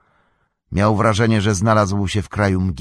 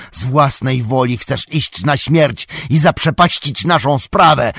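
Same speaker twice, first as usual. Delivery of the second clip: high, loud furious shout; wściekły wrzask; 7 semitones higher, 7 dB louder than usual. A narrator's reading. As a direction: yell in rage as the warrior. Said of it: "wrzasnął Boromir". (wściekły, głośny krzyk)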